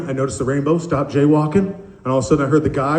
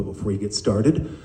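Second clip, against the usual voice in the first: call-centre disclaimer; 7 semitones lower than usual. Retold or imitated. Mimics recorded call-centre disclaimer.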